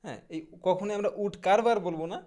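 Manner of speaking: ordinary talk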